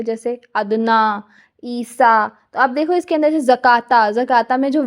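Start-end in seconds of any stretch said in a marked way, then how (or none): 0.5-2.3 अदना and ईसा with final vowels held long; slow demo of अदना, ईसा with lengthened vowels; drawn-out long vowels. Shown as a demonstration. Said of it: "उसको लंबा खींच के पढ़ोगे".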